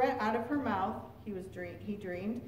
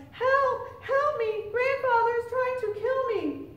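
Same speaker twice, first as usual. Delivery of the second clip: very high, loud panicked plea; crying for help; 12 semitones higher, 5 dB louder, slower than usual. A narrator's reading. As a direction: cry out for rescue. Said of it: "He stumbled inside her door crying".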